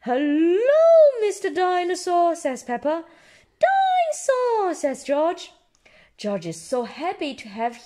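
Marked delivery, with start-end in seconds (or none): 0.0-2.4 put-on high, loud child's hello; high-pitched joyful Peppa; delighted greeting. A narrator's reading. Peppa greets the mended toy. 3.6-4.7 high, loud, drawn-out cry; stretched squeal of delight; excited little George. A narrator's reading. George cries toy's name.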